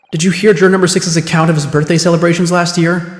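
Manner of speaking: ordinary delivery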